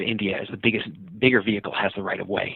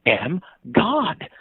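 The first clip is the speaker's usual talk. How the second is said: mock earnest; 7 semitones higher, much slower than usual. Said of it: "with earnestness and said".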